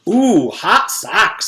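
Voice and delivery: slow, drawn-out high "ooh"; admiring girl. A narrator's reading. Mauricia admires Mac's socks, slow and impressed. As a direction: savour it like the socks are cool.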